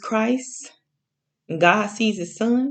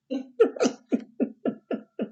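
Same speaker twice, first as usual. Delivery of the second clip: high giggle; 8 semitones higher than usual. Real, not acted. Laughs at her own word.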